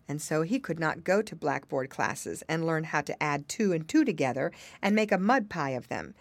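plain reading voice

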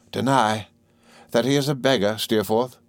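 usual speaking voice

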